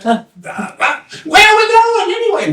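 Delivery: high, questioning call; calling down the line. Acted. People in line asking each other, high and questioning. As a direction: pass the question forward.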